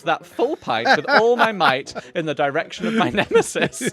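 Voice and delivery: hammed-up old auntie through laughter; elderly lady voice, laughing. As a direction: read it grand and high while cracking up. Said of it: "my elderly lady accent".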